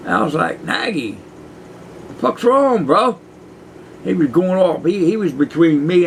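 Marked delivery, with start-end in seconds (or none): 0.6-3.2 startled confusion. Retold or imitated. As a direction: jump up in pitch, caught off guard. Retold shock at cellmate's outburst.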